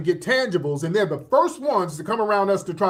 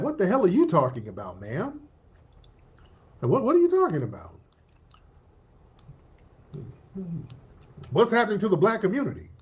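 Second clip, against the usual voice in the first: mock confusion. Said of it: "And people are like".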